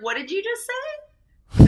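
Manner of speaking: high, slow stunned question; mock disbelief at the child; shocked parent. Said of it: "we were like".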